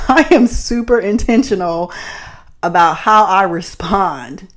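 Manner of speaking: usual speaking voice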